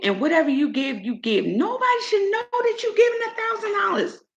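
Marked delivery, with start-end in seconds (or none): 1.4-4.2 high-pitched indignant insistence; real heated outburst; fired up. Real, not acted. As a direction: make the point heatedly, pitch way up. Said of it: "I'm all fired up".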